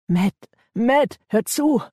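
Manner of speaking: high, desperate appeal; urgent pleading. A narrator's reading. Ben appeals to the armed gang leader, urgent. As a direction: beg him to listen. Ben begs gunman to listen.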